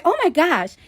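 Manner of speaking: put-on high-pitched Staten Island girl gasping; Staten Island accent, startled and pitched high.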